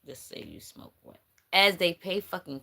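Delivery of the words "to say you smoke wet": dropped to a voiceless whisper. Real, not acted. Reader warning the viewer about a paid rumour, whispering. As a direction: whisper the rumour under your breath.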